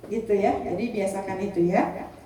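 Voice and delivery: ordinary delivery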